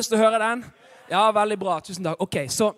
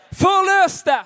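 loud, high, drawn-out proclamation; call-and-response shout; 9 semitones higher, 7 dB louder than usual. Real, not acted. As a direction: call the word out for the congregation. Leads congregation in saying word. (høyt, langtrukkent tilrop)

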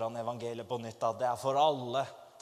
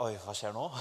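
nervous laugh; 6 semitones lower, 4 dB quieter than usual. Retold or imitated. Nervous at approaching tough man.

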